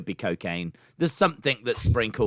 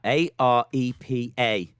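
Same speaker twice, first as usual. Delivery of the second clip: spelled out; slower than usual. Shown as a demonstration. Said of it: "repeating spelling slowly".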